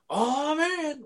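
"Oh man" yelled high and loud in fake delight; fake-cheer "Oh man" like it's amazing; mock excitement. Shown as a demonstration. Showing the inside joke, a mock-excited high yell. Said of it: "we act like we're really excited about it by yelling".

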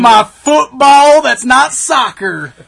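loud, high, slow hammed-up Texan drawl; mock Southern Texas accent. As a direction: boast like a cartoon Texan trucker. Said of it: "wanna do the American accent".

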